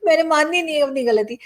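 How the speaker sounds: amused stubbornness. Real, not acted. Vlogger admitting to viewers she never concedes, amused stubbornness. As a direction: laugh into a playful refusal. Laughs, jokingly refuses to concede.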